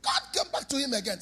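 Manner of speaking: ordinary delivery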